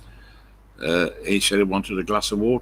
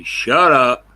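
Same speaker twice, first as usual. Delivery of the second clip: retold yell; 6 semitones higher, 11 dB louder than usual. Brother yelling back at Bomi.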